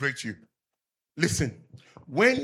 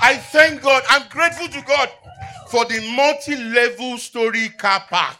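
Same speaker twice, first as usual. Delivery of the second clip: much higher and louder, full-throated; shouted preaching; 7 semitones higher, 10 dB louder than usual. Acted. Pastor rousing the congregation, shouted praise. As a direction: shout your thanks to God.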